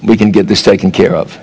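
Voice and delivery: low, gentle reassurance after a fender bender; lightly voiced gentle driver; calm kind reply. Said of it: "you can be like".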